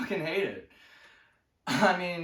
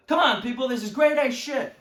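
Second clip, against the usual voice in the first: louder, higher protest to the crowd; exasperated appeal; 6 semitones higher, 6 dB louder than usual. Acted. Comic scolding a silent audience, exasperated appeal. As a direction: push it out in indignant disbelief. Pleading with unresponsive audience after joke.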